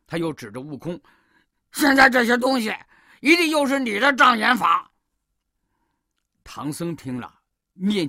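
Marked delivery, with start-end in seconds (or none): 1.7-4.9 loud, high, indignant pig character; huffy accusation. A narrator's reading. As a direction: accuse him hotly. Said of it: "气呼呼地对唐僧说". (气呼呼地高声指责)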